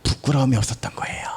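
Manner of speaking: breathy hushed whisper, no voice. Real, not acted. Preacher to congregation, whispering the point. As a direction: lean in and whisper it. Climax on Paul's clear conscience.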